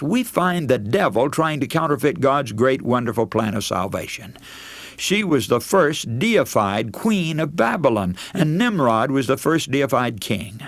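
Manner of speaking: ordinary talk, nothing marked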